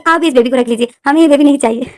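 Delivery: put-on high, loud stubborn little boy; whiny child. Little boy refusing to take the baby home, loud whine. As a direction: refuse like a spoiled kid.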